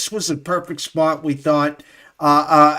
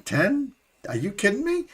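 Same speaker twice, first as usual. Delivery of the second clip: higher, disbelieving questions; incredulous quote; 4 semitones higher, 6 dB quieter than usual. Retold or imitated. Sports host mimicking bettors shocked at the point spread, incredulous. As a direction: imitate bettors balking in disbelief.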